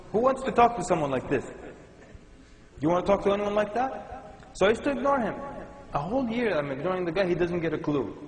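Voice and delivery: normal talking voice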